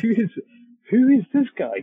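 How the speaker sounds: loud, high-pitched mock astonishment; incredulous. Teller replaying amazement at what his friend had done, incredulous. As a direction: repeat the question in hammed-up disbelief. Repeats the amazed question, laughing.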